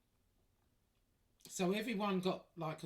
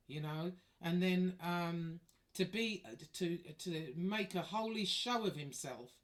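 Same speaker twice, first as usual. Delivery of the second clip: usual speaking voice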